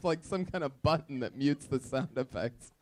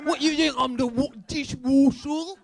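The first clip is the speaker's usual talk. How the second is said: mock British accent; 10 semitones higher, 4 dB louder than usual. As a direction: play an offended Cockney. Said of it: "make us sound like Oliver Twist".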